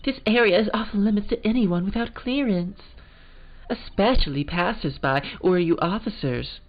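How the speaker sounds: high, prim British lady voice; British accent. Acted. Lunch lady shooing off passers-by, in a British accent. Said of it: "back to the kind of the British one".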